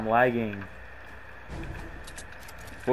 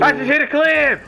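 hyped gamer bro; 6 dB louder than usual. Acted. Repeated hyped catchphrase yell.

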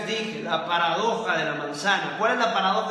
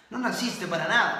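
normal talking voice

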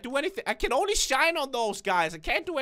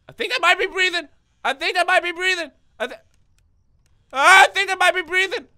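louder, higher, building to a near yell; rising alarm; 5 semitones higher, 8 dB louder than usual. Real, not acted. Player thinks the bear is breathing, alarm building. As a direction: repeat it with mounting alarm.

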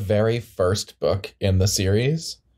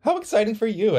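thrilled reaction; 12 semitones higher than usual. Acted. Eddie Rue hearing they are in trouble, thrilled high squeal. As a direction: squeal it with delighted surprise.

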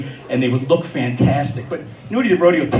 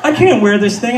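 panicked thought; 6 semitones higher than usual. Retold or imitated. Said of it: "and I'm thinking".

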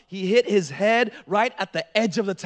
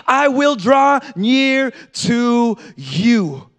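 loud, driving sermon delivery; full-force preaching; 4 semitones higher, 9 dB louder than usual. Real, not acted. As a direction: build to a booming promise, stretching the last words.

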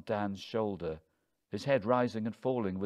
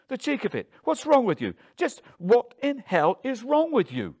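very high, loud tirade; furious, indignant shouting; outraged; 10 dB louder than usual. A narrator's reading. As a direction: tear into him, voice pitched way up. Wife explodes at husband.

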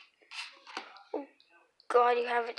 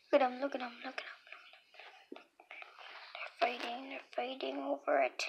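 whispering; 7 dB quieter than usual. Real, not acted. Filming kittens, whispering.

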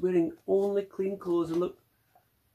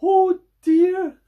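dismayed cry; 10 dB louder than usual. Acted. Bob dismayed in story.

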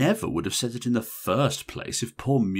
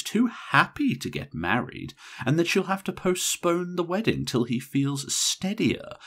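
falsely patient; 6 semitones higher than usual. A narrator's reading. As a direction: talk down with fake patience. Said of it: "spuriously patient tone of voice that a teacher might take".

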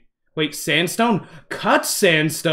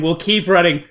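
ordinary talk

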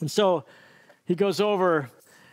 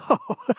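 short laugh; 6 semitones higher than usual. Real, not acted. Amused laugh after the command.

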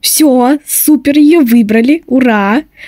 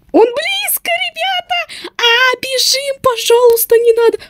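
being chased; 12 semitones higher, 4 dB quieter than usual. Real, not acted. Seeker close, chasing her.

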